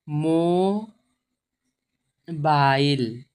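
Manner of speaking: drawn-out mo... baa-il with a long gap; very slow sounding-out. Shown as a demonstration. Teacher breaking down a new word, very slow sounding-out. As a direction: stretch mobile out syllable by syllable.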